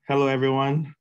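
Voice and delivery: normal talking voice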